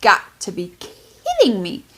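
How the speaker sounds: high, incredulous "are you kidding me"; rising outrage at a bad ending; disbelief. A reader fuming at the book's ending, incredulous. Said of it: "I was like".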